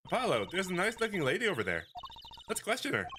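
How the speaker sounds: put-on high, bright-eyed girl voice; perky girl sidekick. Young assistant urging her partner out, high and eager. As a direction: chirp it like an eager young magician.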